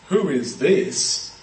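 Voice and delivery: drawn out slowly, each word weighed; slow weighty question. Shown as a demonstration. Preacher giving the crowd's real question, slow and searching. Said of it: "what they mean by that is".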